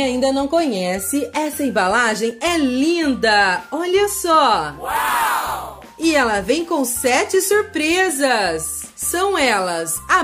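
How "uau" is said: gasped amazed wow; breathy wow. Real, not acted. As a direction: sound truly amazed. Amazed at packaging.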